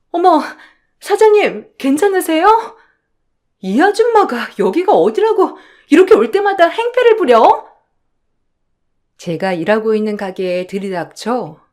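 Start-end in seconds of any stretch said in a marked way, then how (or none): 0.1-7.7 high, loud voice of an outraged staffer; agitated defender of the boss; alarmed, indignant employee. Acted. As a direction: cry out in alarm, then tear into the intruder.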